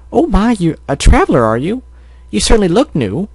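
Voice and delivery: high-pitched bakery lady, curious; friendly shopkeeper. Acted. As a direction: greet a traveler in a high, lilting voice. Voices Mrs. Cake in story.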